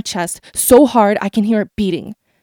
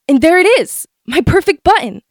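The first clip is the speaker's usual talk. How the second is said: high, thrilled relief; overjoyed to find it; excited; 6 semitones higher, 4 dB louder than usual. A narrator's reading. Joy at finding button.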